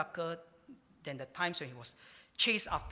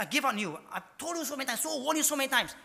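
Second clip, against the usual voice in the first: raised, scolding voice of a fed-up God; hammed-up exasperated rebuke; 6 semitones higher, faster than usual. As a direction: scold like you've warned them a hundred times. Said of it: "He said".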